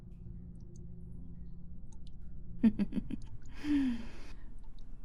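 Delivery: long breathy chuckle; soft cruel laugh. Acted. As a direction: laugh softly at your prey. Villain delighted by captive.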